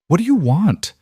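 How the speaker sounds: high, cracking plea; a frightened woman's voice cracking under threat; broken voice. Acted. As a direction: let the voice break as you ask. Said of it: "Maya's voice came out broken".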